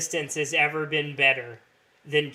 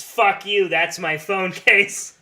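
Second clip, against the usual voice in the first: mock angry; 4 semitones higher, 7 dB louder, slower than usual. Imagined reply to a stranger.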